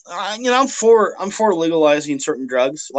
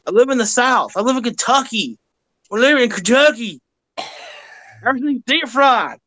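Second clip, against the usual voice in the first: put-on Southern accent; 5 semitones higher than usual. Joking to his cohost about living in Kentucky, in a put-on Southern accent. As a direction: lay on a Kentucky Southern accent, playing it up for laughs. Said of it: "you can actually do that Southern accent".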